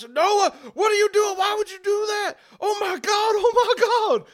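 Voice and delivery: high, loud horrified yelling; panicked shout. Acted. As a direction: scream in disbelief. Irving yells at acid attack.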